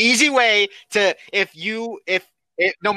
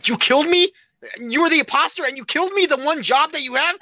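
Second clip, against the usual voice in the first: high-pitched mock indignation; outraged friend impersonation, pitched up; Jonathan; 7 semitones higher than usual. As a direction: act incredulous and betrayed. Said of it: "Jonathan would say".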